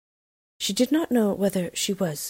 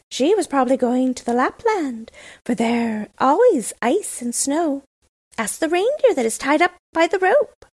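high, loud cooing pigeon voice; cooing wood pigeons; 9 semitones higher, 6 dB louder than usual. A narrator's reading. Pigeons answering Gerda.

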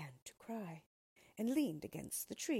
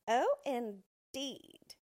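loud, drawn-out, higher-pitched scorn; disdainful; 6 semitones higher, 8 dB louder, much slower than usual. A narrator's reading. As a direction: stretch it out with haughty disdain.

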